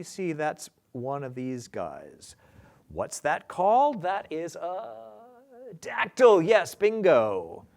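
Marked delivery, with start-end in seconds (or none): 2.9-7.7 excited. Real, not acted. Teacher cheering a correct answer, loudly excited. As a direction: build it up like a big reveal.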